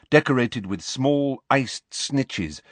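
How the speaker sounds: usual speaking voice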